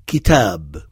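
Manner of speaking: kitab with a clear i, said slowly; model pronunciation. Shown as a demonstration. Teacher gives the Arabic word for book, slow and careful. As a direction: say ki-tab carefully, not ka. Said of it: "il ne faut pas prononcer sur le modèle de Kamal".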